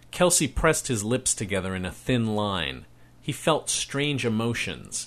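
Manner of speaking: ordinary delivery, nothing marked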